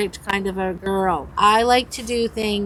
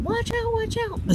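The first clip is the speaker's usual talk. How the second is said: high warning shout; 13 semitones higher, 4 dB quieter than usual. Retold or imitated. Grandmother screaming again as the umbrella flies at them. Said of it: "And I'm screaming".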